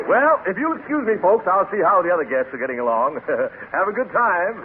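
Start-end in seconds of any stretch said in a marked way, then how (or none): none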